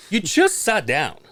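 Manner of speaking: high, drawn-out disbelief; incredulous complaint, pitched well up; exasperated retort. Retold or imitated. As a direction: stretch it out in mock exasperation. Fed up with neighbour's requests.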